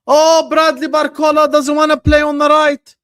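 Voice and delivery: hammed-up imitation of a doubter; mock critic whine. Mocking a critic's objection.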